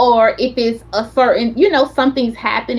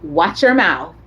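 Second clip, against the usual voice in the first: stern husband; 7 semitones lower than usual. Retold or imitated. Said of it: "And my husband would".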